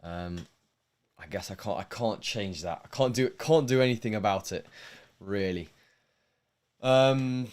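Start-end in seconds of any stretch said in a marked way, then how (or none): none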